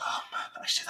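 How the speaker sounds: breathy hushed whisper; soft unvoiced whisper of surprise; whispered aside. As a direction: whisper it to yourself in awe. Recalls listening back, hushed.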